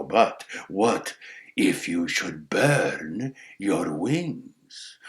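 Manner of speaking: low, slow crow question; needling crow. Acted. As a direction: draw out the threat as the crow. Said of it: "says the crow".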